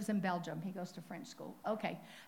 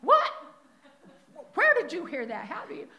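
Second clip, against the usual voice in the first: shocked mother; 8 semitones higher, 9 dB louder than usual. Retold or imitated. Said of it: "And his mother is like".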